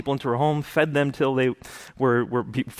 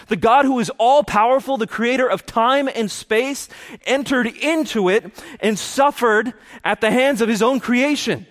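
a preacher carried away by awe; impassioned preaching; 9 semitones higher than usual. Acted. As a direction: build the wonder and urgency for the congregation. Preaching rises to full force.

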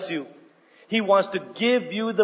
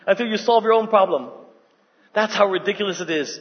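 usual speaking voice

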